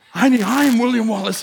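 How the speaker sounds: mock heroic declaration. Husband telling his wife he is William Wallace, loud mock heroic. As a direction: puff up and proclaim it like a movie hero. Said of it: "And she's like, "No, you're not".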